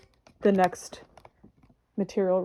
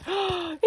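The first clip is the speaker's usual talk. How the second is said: high sharp gasp of delight; reenacted gasp; 8 semitones higher than usual. Recreating unboxing the star foil, a high gasp. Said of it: "the sound I made when I got this out".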